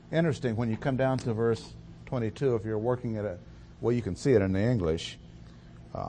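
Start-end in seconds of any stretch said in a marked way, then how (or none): none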